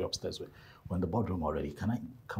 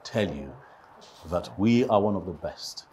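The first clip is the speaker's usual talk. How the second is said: sales pitch; 5 dB louder than usual. Acted. Rehearsed investor pitch.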